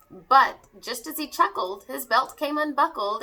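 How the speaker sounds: usual speaking voice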